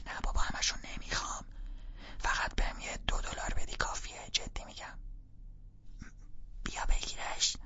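urgent hushed whisper. Acted. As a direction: keep it down, parents are home.

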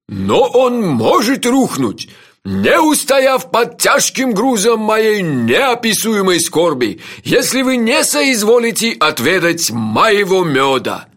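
slow, loud, florid declamation; high, stretched ceremonial bellow; grandiose shout. A narrator's reading. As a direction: boom out the lament as a lordly host. Host answers in shouted conversation.

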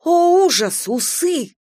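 high, startled cry of horror; frightened gasp. A narrator's reading. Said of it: "испуганно ахнула". (испуганный вскрик)

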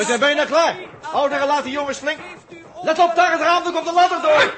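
high, loud warning cries; alarmed shout. Acted. Jaap warning the elders as robots climb the ladder, shouting. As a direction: yell a warning at the window.

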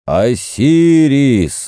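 slow, high ringing call; exclamation. Acted. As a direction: call out to the god. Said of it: "воскликнул с террасы Херихор".

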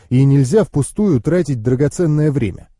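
plain reading voice

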